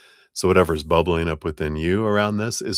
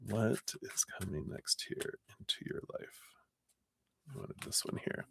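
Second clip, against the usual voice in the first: softer whisper while picking a card; whispered to self; 4 semitones higher, 18 dB quieter than usual. Real, not acted. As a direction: murmur it to yourself, barely voiced. Mutters while shuffling cards.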